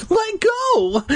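high, strained cry to be released; choked-out plea. A narrator's reading. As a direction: choke it out while being shaken. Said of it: "I finally managed to choke out".

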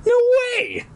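very high squealed refusal; cried out. A narrator's reading. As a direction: shriek it in hammed-up panic. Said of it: "I cried".

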